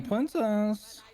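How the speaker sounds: high, slow lisping princess; cartoon princess. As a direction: coo princess in a drawn-out lisp. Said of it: "I'll use the princess voice".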